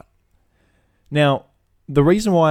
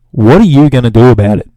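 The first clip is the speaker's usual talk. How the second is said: loud, challenging customer's sneer; mock defiant shout; 11 dB louder than usual. Customer daring the shop clerk, loud mocking challenge. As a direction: throw the challenge back loudly.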